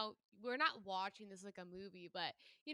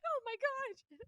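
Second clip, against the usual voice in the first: put-on much higher startled little boy; imitating shocked kid; slower than usual. Retelling the boy realizing his mistake, high startled gasp. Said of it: "he's like".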